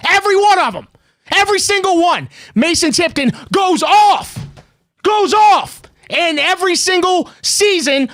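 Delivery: exasperated, climbing rant that breaks into yelling; heated rant. Real, not acted. Host ranting to a caller about a vanishing receiver, exasperated shouting.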